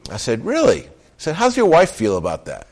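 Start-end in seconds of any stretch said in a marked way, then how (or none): 0.4-0.8 high surprised "Really?"; playful high-pitched disbelief; retold surprise. 1.3-2.7 teasing question. Retold or imitated.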